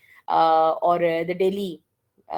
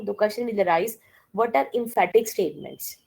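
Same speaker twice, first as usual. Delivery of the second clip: ordinary talk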